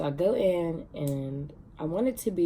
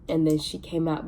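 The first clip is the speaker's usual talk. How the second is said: usual speaking voice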